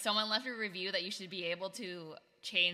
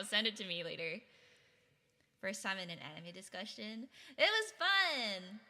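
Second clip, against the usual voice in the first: ordinary talk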